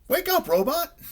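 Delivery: very high, urgent wake-up call. A narrator's reading. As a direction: yell to rouse a sleeper. Rabbit calls to sleeping Robot.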